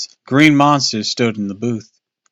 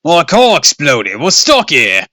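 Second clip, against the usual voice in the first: angry shout; 5 semitones higher, 9 dB louder than usual. Acted. Dad shouting at monster.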